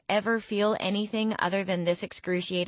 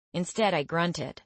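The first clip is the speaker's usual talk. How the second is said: ordinary delivery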